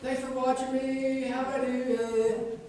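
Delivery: put-on high, singsong kid voice; carefree child, words slow and drawn out; imitating his child. Voicing his carefree child after race.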